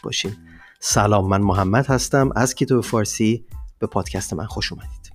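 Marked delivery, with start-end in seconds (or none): none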